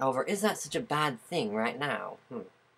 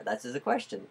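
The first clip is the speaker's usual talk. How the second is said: normal talking voice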